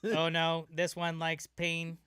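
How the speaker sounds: loud, slow alarmed robot; a machine caught off guard. Pretend. Robot surprised by subject.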